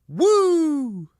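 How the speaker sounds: high shouted wrestler's woo; Ric Flair woo. Retold or imitated. Podcast host imitating Ric Flair's trademark woo, shouted high. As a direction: let out a big high Flair-style woo. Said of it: "the woo always makes me cough".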